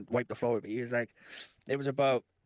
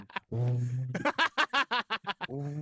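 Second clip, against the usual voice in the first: buzzing, pulsing hum; long droning hum in pulses; imitated body vibration. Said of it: "my whole body was going".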